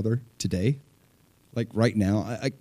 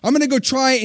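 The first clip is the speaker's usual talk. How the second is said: much higher, fast and eager, a bit flustered; high, rushed, earnest plea to God; nervous eager prayer; 12 semitones higher, 4 dB louder than usual. Retold or imitated. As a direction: pray like a nervous new believer stepping out. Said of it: "I might go like".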